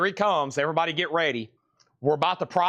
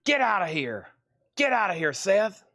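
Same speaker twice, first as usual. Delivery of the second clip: higher mock-angry dog voice; voicing the dog; 6 semitones higher than usual. Owner dubs his dog's chase video: higher mock scolding. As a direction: play the dog yelling at Seth to get out, higher. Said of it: "One more time".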